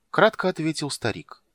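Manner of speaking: ordinary delivery, nothing marked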